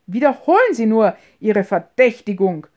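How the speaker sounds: loud, challenging cry; angry shout. A narrator's reading. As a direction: fling out an angry dare. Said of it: "schrie Behrmann".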